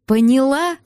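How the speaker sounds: sharply rising question on ПОНЯЛА; mildly heated, rising demand; insistent demand. A narrator's reading. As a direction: press for an answer, voice climbing. Angry man demands she understand.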